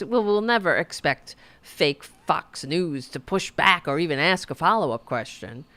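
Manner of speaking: ordinary delivery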